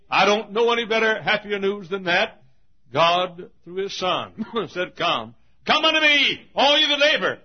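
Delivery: high, loud pulpit proclamation; fervent revival preacher; full-force preaching. Acted. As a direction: build to a shouted invitation.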